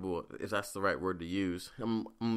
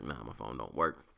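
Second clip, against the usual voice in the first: low flat refusal; 10 semitones lower, 5 dB quieter than usual. Retold or imitated. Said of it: "I was just like".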